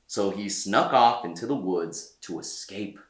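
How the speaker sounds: plain reading voice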